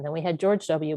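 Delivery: normal talking voice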